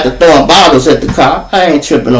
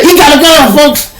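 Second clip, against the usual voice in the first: mock panicked yell about a gun; alarmed crowd shout; 11 semitones higher than usual.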